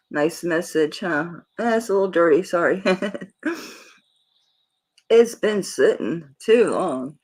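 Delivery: ordinary delivery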